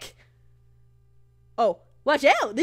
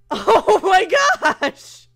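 drawn-out, higher, louder gasp of disbelief; shocked; 4 semitones higher, 5 dB louder, much slower than usual. Real, not acted. Player reeling after the scare, shocked drawl. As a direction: stretch it out slowly in shock.

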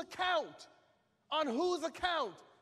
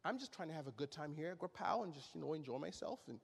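lower, much softer, fast mocking believer; 11 semitones lower, 11 dB quieter, faster than usual. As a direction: brush it off like a timid churchgoer. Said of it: "most believers like to say".

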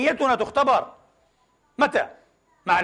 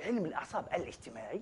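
علم الأعصاب الاجتماعي repeated much softer, almost to himself; quiet repeat; 4 semitones lower, 14 dB quieter, faster than usual. Shown as a demonstration. Preacher repeating the field's name to himself, hushed. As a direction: echo the name under your breath.

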